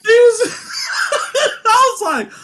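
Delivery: high, laughing squeal through the words; can barely get it out for laughing. Real, not acted. Laughing with friend over Wesker fight. Cracking up telling story.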